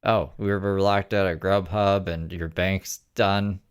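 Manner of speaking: ordinary talk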